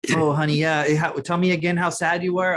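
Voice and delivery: high, put-on syrupy mom voice; mock sappy mom. Parent filming her kid, syrupy mock coo. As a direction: coo it like a fake-sweet mom. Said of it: "they're like".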